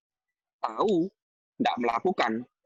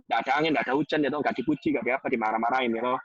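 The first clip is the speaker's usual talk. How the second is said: usual speaking voice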